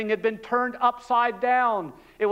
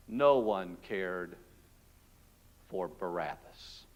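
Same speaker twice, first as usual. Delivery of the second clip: slower, much lower and fading out; bitter lament, fading into silence; 14 semitones lower, 9 dB quieter than usual. Acted. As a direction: let the repeat sink to almost nothing.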